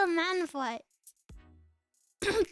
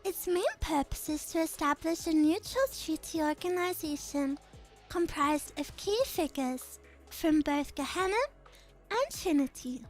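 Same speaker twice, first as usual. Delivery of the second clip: lower, measured and calm; composed noble girl; 6 semitones lower, 3 dB quieter than usual. Acted. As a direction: speak with quiet authority. Same character voice continues.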